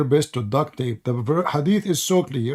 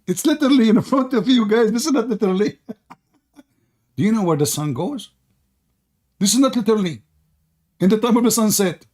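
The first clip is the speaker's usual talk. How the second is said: high, louder exasperation; exasperated; 7 semitones higher, 3 dB louder than usual. Real, not acted. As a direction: raise it in disbelief. Exasperated raised voice.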